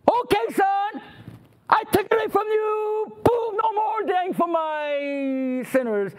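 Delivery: high, ringing hypothetical decree; hammed-up fatherly announcement; mock proclamation. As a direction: declare a sweeping reprieve, drawing out the end. Acting Father granting a hypothetical reprieve.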